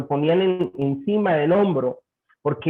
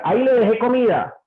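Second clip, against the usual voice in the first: a snappy, cold \"ahí le dejé comida\", louder and higher; curt, cold; 6 semitones higher, 3 dB louder than usual. Retold or imitated. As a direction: throw the line at her like you're fed up. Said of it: "a que le diga". (respuesta seca y fría a la esposa)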